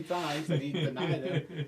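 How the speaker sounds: slow words broken by real laughter; laughing through words. Real, not acted. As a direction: let the laugh spill into the line. Speaker laughing mid-sentence.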